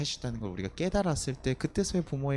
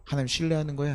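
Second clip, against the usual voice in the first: ordinary delivery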